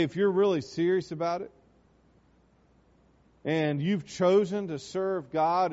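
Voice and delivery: ordinary talk, nothing marked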